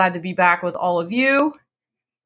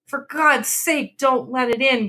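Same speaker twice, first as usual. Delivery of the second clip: high, slow, fearful begging; terrified plea; 8 semitones higher than usual. A narrator's reading. Old man begging his wife not to open the door, high terrified plea. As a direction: beg her not to open it. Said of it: "cried the old man, trembling".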